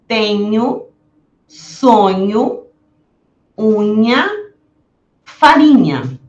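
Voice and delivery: each word drawn out slowly, nh stressed; slow careful nh words; slow model words. Shown as a demonstration. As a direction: stretch the nh for learners. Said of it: "vamos ver alguns exemplos".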